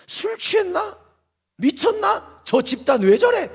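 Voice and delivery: high-pitched incredulous questions; scandalised onlooker, pitched well up; mock jeering bystanders. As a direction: imitate outsiders gawking at a crazy crowd. Voices bystanders jeering at church.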